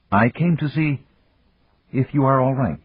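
high, tentative woman's voice; uncertain, questioning. A narrator's reading. Woman checks on the man after a killing, uncertain. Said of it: "Her voice was low, uncertain, questioning".

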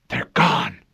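usual speaking voice

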